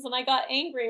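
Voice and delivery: usual speaking voice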